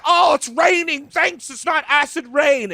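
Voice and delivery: high, shouted mock delight; grateful Londoners. Coal-faced Londoners thankful for the rain, hammed-up high delight. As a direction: cry it out like thankful Dickensian Londoners. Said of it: "they're like".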